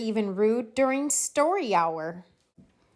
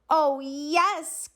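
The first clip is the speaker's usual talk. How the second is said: put-on eager kids; 7 semitones higher than usual. A narrator's reading. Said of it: "cried the kids".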